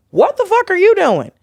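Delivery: high-pitched, incredulous outburst; inner outrage. Retold or imitated. Retelling her reaction to the tactless comic, high-pitched outrage. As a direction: let the disbelief leap right up in pitch. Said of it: "like, in my brain".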